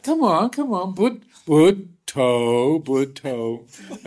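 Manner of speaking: hammed-up urging, laughing at the end; goading teacher. Teacher goading pinned disciple.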